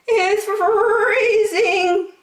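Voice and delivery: very high, slow, drawn-out rabbit voice; tiny rabbit at the door, slow and high; shivering rabbit. A narrator's reading. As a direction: stretch it out like a frozen little rabbit. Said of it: "said one rabbit".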